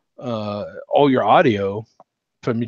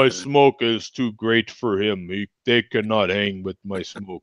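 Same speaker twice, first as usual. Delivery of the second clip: put-on Russian accent; 4 semitones lower than usual. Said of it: "I don't know why I go to Russian accent for him".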